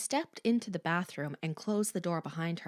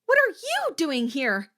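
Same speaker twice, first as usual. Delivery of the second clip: loud, high alarmed question; startled and scared, shouted; a scared girl confronting an intruder, shouted; frightened demand; 11 semitones higher, 8 dB louder than usual. Acted.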